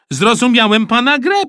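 loud, high eager voice; excited announcement. A narrator's reading. Boy telling supervisor he gets it, excited. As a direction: blurt it out proudly.

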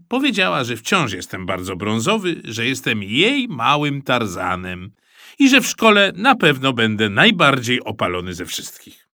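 ordinary delivery, nothing marked

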